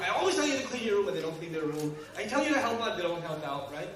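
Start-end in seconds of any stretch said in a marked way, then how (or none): 0.0-1.1 louder, fast nagging yell; mock yell. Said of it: "'Cause you yell". 2.2-3.0 mock yell. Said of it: "'Cause you yell".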